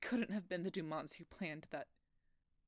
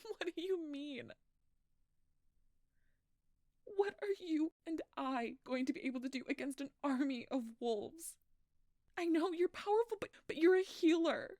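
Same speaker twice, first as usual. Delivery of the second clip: loud, high, desperate disbelief; pleading with Doc, near tears; panicked; 10 semitones higher, 10 dB louder than usual. Acted. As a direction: push back in frantic fear. Panicked outburst at the doctor.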